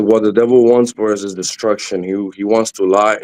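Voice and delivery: ordinary talk, nothing marked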